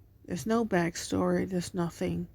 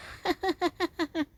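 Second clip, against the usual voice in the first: put-on childlike giggle; 9 semitones higher than usual. Children's laughter voiced.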